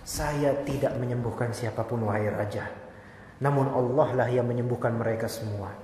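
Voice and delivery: calmly deflect the credit to God; humble quiet reply. Acted. Pious boy answers the king.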